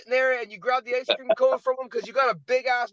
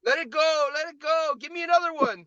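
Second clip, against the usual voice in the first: mock pushy universe; 6 semitones higher than usual. Voicing the universe handing the next one.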